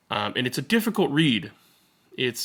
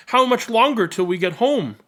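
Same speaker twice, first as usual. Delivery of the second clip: higher, lightly voiced whiny kid asking; impatient little boy; 9 semitones higher, 5 dB louder than usual. A narrator's reading. Boy on the truck asking his sister again, impatient and higher. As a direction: ask like a kid who can't wait.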